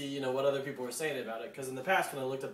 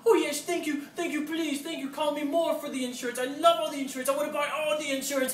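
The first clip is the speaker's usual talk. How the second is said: much higher, manic gushing voice; unhinged crazy guy; 11 semitones higher, 5 dB louder than usual. Retold or imitated. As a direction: play a crazed fan of insurance, overjoyed. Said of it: "crazy guy getting unhinged mode".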